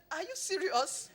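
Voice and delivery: high, slow, startled are you serious; surprised disbelief. Retold or imitated. Friend startled by her threat to cry, high and surprised. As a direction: act the friend caught off guard by tears. Aliko's surprised reply retold.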